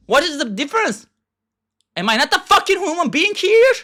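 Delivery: high, indignant shout; angry outburst. Real, not acted. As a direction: demand it with rising fury. Ranting about unfair social media.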